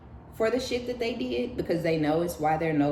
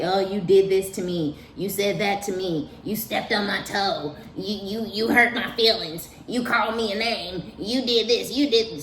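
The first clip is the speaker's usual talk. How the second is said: higher, nagging list of accusations; whiny accuser; 4 semitones higher, 3 dB louder than usual. Retold or imitated. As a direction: mimic a petty ex whining about every grievance. Voicing the drama-starter ex complaining.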